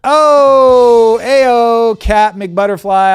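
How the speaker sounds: high, loud whoop of delight at the pun; delighted shout. Real, not acted. Cohost reacts to a border pun with a loud high whoop. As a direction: yell it out, thrilled by the joke.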